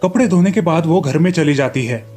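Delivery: usual speaking voice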